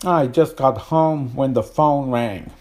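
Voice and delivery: a light American accent on the sentence. Shown as a demonstration. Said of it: "it's American English".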